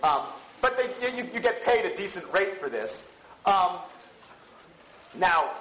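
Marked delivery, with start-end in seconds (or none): none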